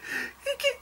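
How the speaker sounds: very high, soft squeaks; tiny mock-crying squeak. Shown as a demonstration. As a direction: imitate a squeaky little sob. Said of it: "other people do the squeak".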